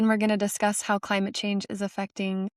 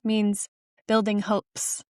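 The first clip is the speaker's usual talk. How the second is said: ordinary talk, nothing marked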